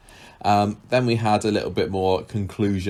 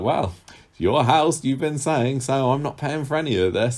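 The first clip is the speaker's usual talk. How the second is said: higher-pitched mock smugness; super smug; 7 semitones higher than usual. Retelling Ken refusing his daughter's bill, mock smug. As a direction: imitate someone smugly refusing to pay. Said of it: "super smug".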